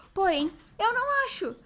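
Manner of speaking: high-pitched exasperated complaint; frustrated fan, voice climbing. Real, not acted. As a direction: lament a fruitless search, pitched high. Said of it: "eu sou muito frustrada".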